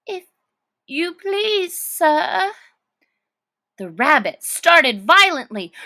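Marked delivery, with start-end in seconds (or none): 0.1-2.5 timid. Acted. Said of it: "in a low, timid voice".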